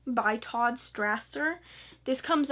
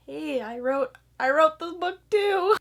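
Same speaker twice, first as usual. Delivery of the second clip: a hint of a tiny, higher voice crying out to be noticed; small overlooked author; 6 semitones higher, 5 dB louder, slower than usual. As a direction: squeak it out from the bottom of the cover. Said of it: "kinda down there like".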